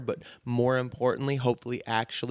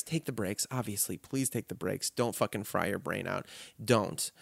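much softer, gentle and earnest; sincere and soft; 5 semitones lower, 10 dB quieter than usual. Real, not acted. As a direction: quietly plead with them, sincerely. Sincere advice after mock quote.

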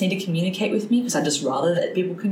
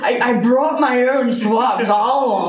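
Bill Murray impression; 5 semitones higher, 4 dB louder, slower than usual. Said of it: "Bill Murray ... just stuffing his face".

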